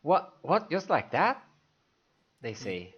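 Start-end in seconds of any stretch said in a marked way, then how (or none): 0.0-1.4 high, louder startled protest; surprised. Acted. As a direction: sputter in disbelief. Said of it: "taken by surprise".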